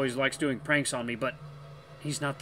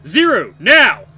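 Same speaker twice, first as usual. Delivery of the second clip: loud, high urgent order; shouted command; 9 semitones higher, 15 dB louder, much slower than usual. Acted. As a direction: bark the order to act.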